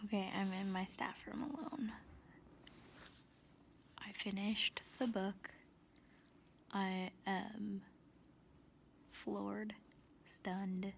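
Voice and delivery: soft, hushed whisper. Real, not acted. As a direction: keep it secret in the staff room. Alone in staff room, whispering.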